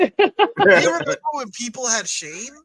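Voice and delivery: high, loud preachy outrage; mock outrage.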